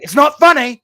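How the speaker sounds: hammed-up indignant outburst; retold indignant yell. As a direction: yell it like a wounded friend, imitating him. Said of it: "And then he was like".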